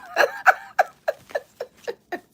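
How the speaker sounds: shrill burst of laughter; high squealing laugh. Real, not acted. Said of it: "big laugh".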